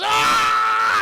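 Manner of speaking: loud, high acted scream; imitated high yell of pain; mock scream of pain. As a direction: scream as if bitten. Acted bitten man screaming.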